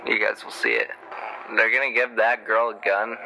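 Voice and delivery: ordinary delivery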